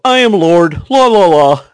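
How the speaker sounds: loud sing-song gloating; South Park Randy. Retold or imitated. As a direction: chant it loudly and smugly. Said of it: "pull a Stan from South Park".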